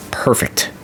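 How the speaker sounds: soft whispered aside. Real, not acted. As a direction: breathe it out quietly.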